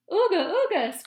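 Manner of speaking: high, very loud cheer; joyous cheer. A narrator's reading. The Grunts cheering their rescuer, loud and joyful. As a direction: whoop with relief. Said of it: "cried the Grunts".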